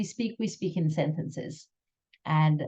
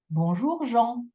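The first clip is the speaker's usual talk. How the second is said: bonjour climbing into a held, higher JEAN; accent on final word; 6 semitones higher than usual. Shown as a demonstration. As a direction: lift and lengthen Jean at the end. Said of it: "Can you hear the extra".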